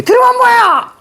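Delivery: very high, indignant cry; acted high-pitched exasperation; exasperated outburst. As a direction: snap it high, fed up with your son. Voices exasperated mother Seonsuk.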